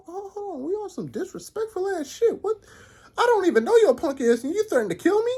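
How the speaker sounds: high incredulous squeal, climbing; outraged disbelief. Real, not acted. Narrator outraged at a stranger's threat, high disbelief. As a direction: sputter in mock outrage.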